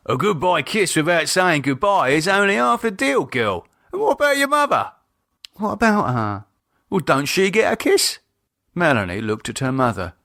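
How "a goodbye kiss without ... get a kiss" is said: much higher cockney dad voice; lightly scolding his girl; dad lecturing daughter; mock-stern dad.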